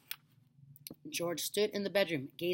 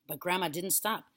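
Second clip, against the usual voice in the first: plain reading voice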